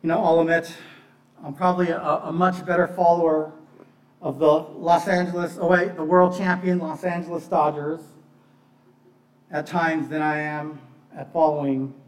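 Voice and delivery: usual speaking voice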